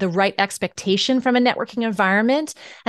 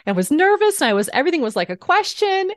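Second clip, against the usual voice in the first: upward inflection; 8 semitones higher, faster than usual. Shown as a demonstration. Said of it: "my voice apparently was going up".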